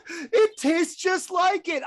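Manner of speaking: high, loud, laughing outburst; yelling while laughing. Retold or imitated. Film fan replaying the banana peel scene, laughing yell. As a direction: drag it out in a high, cracking-up yell. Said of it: "I'm yelling, going".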